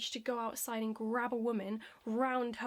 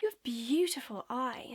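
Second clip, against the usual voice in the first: slow, louder drawn-out flirting; creepy come-on; 4 dB louder, slower than usual. Shown as a demonstration. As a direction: imitate a pushy stranger, lingering on "beautiful". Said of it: "Let me just show you what it's like".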